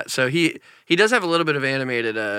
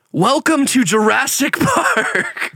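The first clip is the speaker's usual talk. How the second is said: movie narrator voice; 11 semitones higher, 5 dB louder, much slower than usual. Pretend. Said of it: "what about this one".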